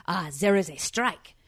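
mock French-accented desk clerk; imitated French receptionist. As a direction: put on a heavy, muddled French accent. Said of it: "with a very heavy French accent".

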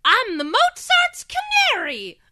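high, slow chirpy canary voice; a little German canary reciting; bird voice. Retold or imitated. Reciting her childhood canary line in a bird voice. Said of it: "a bird voice".

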